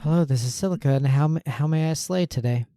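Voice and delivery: a touch of chirpy call-center cheer; customer service voice. Pretend. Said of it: "Your customer service voice".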